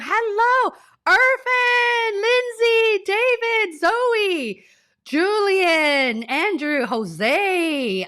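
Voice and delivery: bubbly shout-outs to the chat, name after name; overjoyed host greeting her viewers; excited roll call. Real, not acted. Excitedly greeting live chat names.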